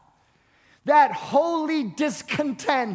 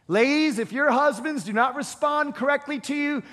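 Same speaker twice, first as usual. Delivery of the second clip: ordinary talk, nothing marked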